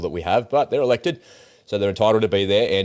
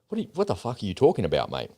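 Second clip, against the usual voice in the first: usual speaking voice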